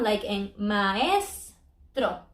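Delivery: maestro drawn out very slowly, ma-es split apart; slow split demo. Shown as a demonstration. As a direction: stretch maestro so both vowels ring apart. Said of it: "We split the two vowels".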